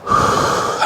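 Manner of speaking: slow breath out through the mouth; calm demonstration exhale; demo exhale. Shown as a demonstration. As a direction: let the air out to show it. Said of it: "out your breath".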